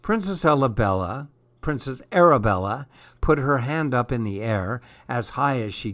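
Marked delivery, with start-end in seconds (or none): none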